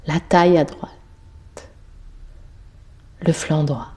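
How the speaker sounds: calm meditation voice, short phrases with long pauses; measured body-scan voice, letting each phrase settle; guided yoga nidra. Real, not acted. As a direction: guide a sleeper through the body, unhurried and even.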